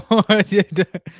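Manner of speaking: sudden loud burst of laughter; big real laugh. Real, not acted. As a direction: crack up. Laughing at own joke.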